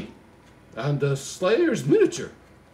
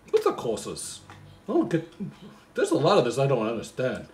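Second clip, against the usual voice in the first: ordinary talk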